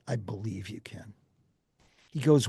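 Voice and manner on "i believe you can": whispered, soft and low; awed whisper. Retold or imitated. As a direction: breathe it out in awe. Reporter answers in awe.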